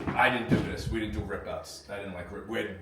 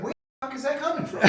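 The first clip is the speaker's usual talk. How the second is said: much higher, fast, laughing confusion; confused, laughing yell; 13 semitones higher, 4 dB quieter, faster than usual. Retold or imitated. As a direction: rattle it off baffled, laughing. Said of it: "and he's like".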